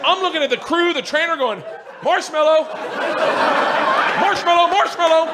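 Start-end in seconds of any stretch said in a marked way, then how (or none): 2.0-5.3 high, urgent calling out; acted panicked repetition; desperate plea. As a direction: cry for help with a safe word. Calling safe word to the trainer.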